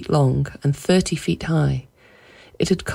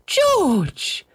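high-pitched, distressed cry. A narrator's reading. Wife crying to her husband after fleeing the lions. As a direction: call his name in high-voiced panic. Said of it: "he was laughing and she was crying".

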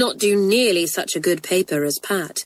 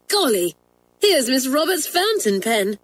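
mildly thrilled, higher schoolgirl voice; excited cry; 6 semitones higher than usual. A narrator's reading. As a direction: announce a find with a touch of surprise. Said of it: "cried Hilary a little later".

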